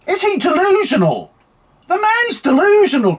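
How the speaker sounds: high-pitched disbelief; can't believe what he's seeing; incredulous. Real, not acted. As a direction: voice jumps up in outrage. Ranting at Starmer's appointment.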